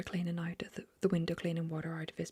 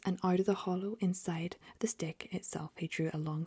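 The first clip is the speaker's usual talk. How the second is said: plain reading voice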